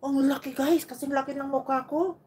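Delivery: high, loud amazement; amazed. Real, not acted. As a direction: wow at the huge orange. Amazed at big orange.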